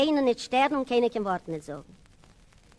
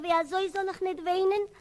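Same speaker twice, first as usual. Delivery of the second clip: higher, whining protest; plaintive outburst; 6 semitones higher than usual. Retold or imitated. Boy whines to the man that they call him Lefzen. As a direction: plead like a hurt boy. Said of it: "Ich sag mir".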